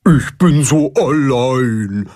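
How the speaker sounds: slow, lonely fish lament; carp bursting out sadly. Acted. Carp blurts his loneliness to friends, slow and sad. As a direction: let the loneliness spill out. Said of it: "platzt es aus dem Karpfen heraus".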